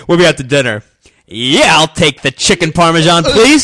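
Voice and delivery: loud, booming impression of a radio host; mocking radio voice. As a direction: order dinner like you're on air. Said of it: "it's his voice".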